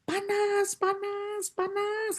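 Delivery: a demon burning and wailing; high demon wail of pain; demon shrieking. Retold or imitated. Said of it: "dia tiba-tiba teriak".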